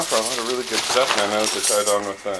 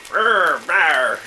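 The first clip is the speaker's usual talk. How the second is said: mock angry growl, a bit louder; playful growl; 3 semitones higher, 3 dB louder than usual. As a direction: growl like a cartoon monster. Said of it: "I'm [name], and I'm angry".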